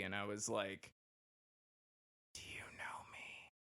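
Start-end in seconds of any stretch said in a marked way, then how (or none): 2.3-3.5 hushed, slow aside; delighted whisper. Retold or imitated. Recruit privately thrilled to learn the dorm routine, whispering. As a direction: whisper it as a gleeful secret.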